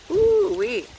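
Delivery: high, loud playful whoop; high whooping ooh-wee. Real, not acted. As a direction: whoop at your own boldness. Reacting to his provocative claim.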